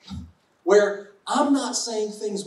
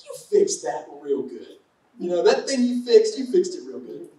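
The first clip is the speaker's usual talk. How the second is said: a wife cooing praise at her handy husband; mock wife praising. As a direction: gush like an admiring wife. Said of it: "we want to think that we're".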